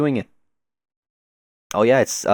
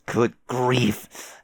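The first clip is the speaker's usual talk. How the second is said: ordinary talk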